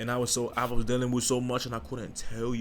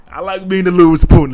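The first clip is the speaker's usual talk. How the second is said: loud playful; 7 semitones higher, 18 dB louder than usual. Real, not acted.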